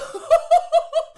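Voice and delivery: high burst of laughter; loud laugh. Real, not acted. Loud laugh at gross guess.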